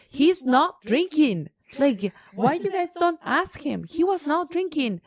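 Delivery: defending him to the office staff, heated; retold heated denial. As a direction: insist on every word, heated.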